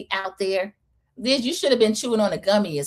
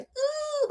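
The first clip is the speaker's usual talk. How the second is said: high squeaky cringing ooh; cringe ooh; 7 dB quieter than usual. Retold or imitated. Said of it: "she gave a face like".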